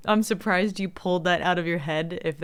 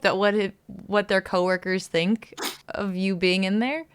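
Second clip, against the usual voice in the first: ordinary talk, nothing marked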